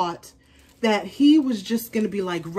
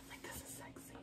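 whisper; 28 dB quieter than usual. Real, not acted. Whispering about the sexy shoe.

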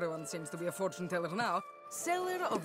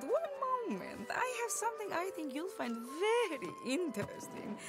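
theatrical delight; 10 semitones higher than usual. Acted. Fortune teller presenting a find, high theatrical delight. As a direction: relish the reveal in a raised pitch.

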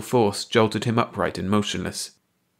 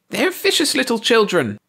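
ranting; 4 dB louder than usual. A narrator's reading. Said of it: "Ursi ranted".

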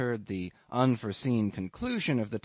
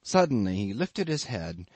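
ordinary delivery

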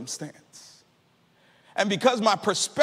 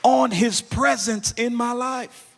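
loud, rising sermon climax over music; preaching at full force; 4 dB louder than usual. Acted. Preacher lifting the congregation over the organ, full force. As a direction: preach it out, louder with each line.

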